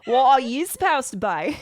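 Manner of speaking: loud bad Aussie accent; Australian accent. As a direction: overdo the Aussie twang. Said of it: "I'm trying not to be super Dundee".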